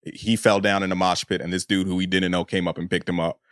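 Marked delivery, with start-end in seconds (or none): none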